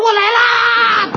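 high, slow drawn-out cry; 拉长声高喊; heroic shout. Retold or imitated. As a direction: charge in like a hero. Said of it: "我说".

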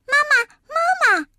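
put-on little kids squealing for their mother; overjoyed children calling. A narrator's reading. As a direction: call out high with delight. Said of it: "欢天喜地地扑过去".

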